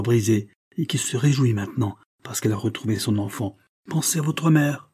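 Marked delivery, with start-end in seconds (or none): none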